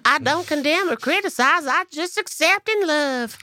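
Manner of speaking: sweet high country voice, lightly sung out. Retold or imitated. Host quoting [name] to listeners in a high Southern voice. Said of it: "She says".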